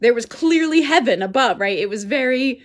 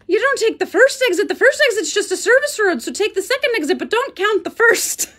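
Irish man's directions; 9 semitones higher, 4 dB louder than usual. Retold or imitated. Gas station man's directions voiced.

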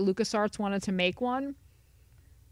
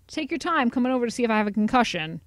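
ordinary delivery

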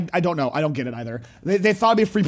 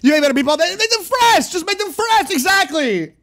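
high, loud outburst at the screen; heated yelling; 8 semitones higher, 4 dB louder than usual. Real, not acted. Streamer yells at the owner about meatballs, heated yelling. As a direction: yell it up high, exasperated.

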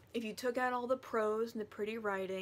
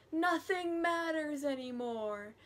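mock despair; 6 semitones higher, 3 dB louder than usual. Retold Humbert despairing while driving away.

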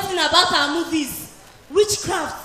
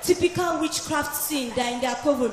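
ordinary talk, nothing marked

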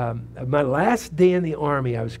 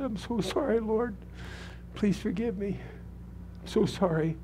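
high, hushed tearful plea to God; softly begging forgiveness, higher and much softer; retold weeping plea; 6 semitones higher, 8 dB quieter than usual. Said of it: "And I was weeping".

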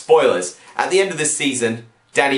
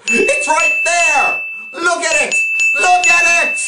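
very high yell, building louder; exasperated shouting; 5 dB louder than usual. Acted. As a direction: yell as if pointing at the obvious. Argues the creature is visible.

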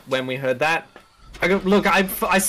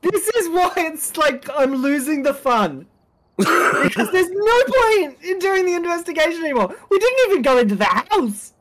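high, drawn-out wail of protest; exasperated complaint; 10 semitones higher, slower than usual. Real, not acted. Player protesting to friends about lost fun, exasperated. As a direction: complain at a much higher pitch, dragging the words. Protesting the game spoils investigation.

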